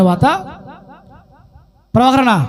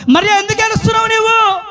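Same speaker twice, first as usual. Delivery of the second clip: high, ringing call; Jesus; 7 semitones higher, faster than usual. Retold or imitated. Jesus asking Mary why she weeps, high ringing call. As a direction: call out the question in one quick raised sweep.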